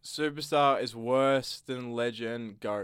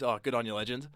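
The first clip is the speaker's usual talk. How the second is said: dry sarcastic "good on you, legend", lower, falling; sarcastic praise; 5 semitones lower, 7 dB quieter than usual. Shown as a demonstration. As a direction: congratulate with biting sarcasm. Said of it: "that's just sarcasm".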